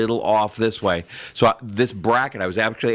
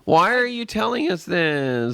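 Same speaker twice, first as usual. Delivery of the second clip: high, drawn-out teasing complaint; mock wail; 10 semitones higher than usual. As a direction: stretch it out in a high, pretend whine.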